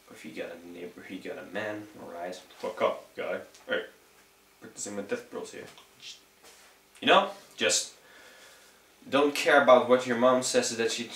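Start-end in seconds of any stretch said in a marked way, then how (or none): 0.1-5.6 muttered aside. Real, not acted.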